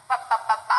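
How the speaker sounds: "b, b, b, b" as repeated lip pops; B sound demo. Shown as a demonstration. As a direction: tap out each "b" with closed lips. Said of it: "The position of your mouth for a B sound".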